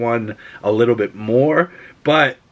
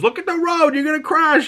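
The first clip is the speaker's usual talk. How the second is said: much higher mock alarm; fancy guy panicking. Doc warning the eating driver, high mock alarm. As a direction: fret about the road like a nervous passenger. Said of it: "He goes".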